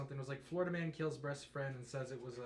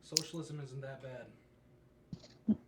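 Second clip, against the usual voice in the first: slow drawn-out; much slower than usual. Shown as a demonstration. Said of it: "Unpopular opinion".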